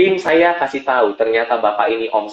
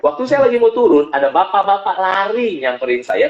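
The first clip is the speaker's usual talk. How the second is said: normal talking voice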